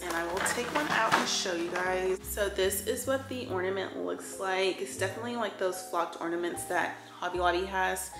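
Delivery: ordinary delivery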